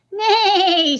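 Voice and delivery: whinny it out like a horse; horse neigh. Acted. Reader playing the horse, playful neigh. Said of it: "said the horse".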